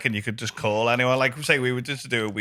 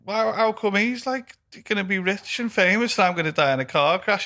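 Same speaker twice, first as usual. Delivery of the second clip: higher-pitched, stammered disbelief; mock bewilderment; 6 semitones higher than usual. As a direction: play the baffled friend protesting. Retelling friend's alarmed reply to fortune teller.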